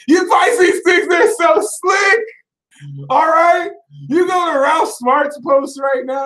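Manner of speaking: heated ranting shout; loud rant. Real, not acted. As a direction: call Pisces out at full volume. Ranting at Pisces.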